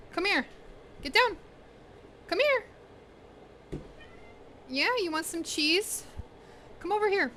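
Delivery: high sing-song pet call; calling the dog. Real, not acted. Calling Popo over for a bit of cheese, high coaxing voice.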